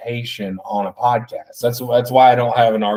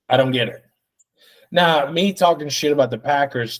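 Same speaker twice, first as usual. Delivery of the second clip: usual speaking voice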